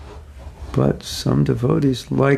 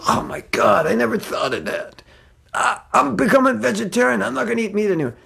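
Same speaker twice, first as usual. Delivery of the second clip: higher, hammed-up shock; mock astonishment; 10 semitones higher than usual. Devotee pretending to be converted, mock astonishment. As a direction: fake a sudden revelation.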